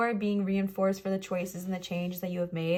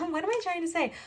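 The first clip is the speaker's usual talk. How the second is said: ordinary delivery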